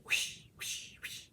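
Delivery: quick airy whooshes; imitated whoosh. Showing how the cat races past camera, whooshing. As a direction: whoosh like something racing past.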